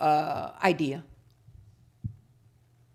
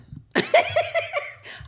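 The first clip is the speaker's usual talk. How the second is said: high burst of laughter; 6 dB louder than usual. Real, not acted. Laugh after "I'm done.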